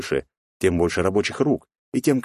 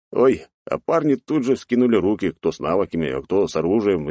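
ordinary delivery

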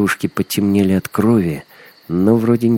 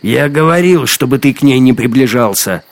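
loud, higher angry outburst; heated, raised-voice reproach; angry rebuke; 5 semitones higher, 6 dB louder than usual. A narrator's reading. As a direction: scold a rival loudly. Hunter angrily rebukes rival.

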